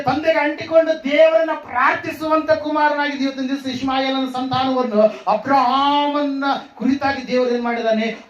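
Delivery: high, forceful sermon shout; fervent preaching. Acted. Preacher asking where their children are, fervent preaching.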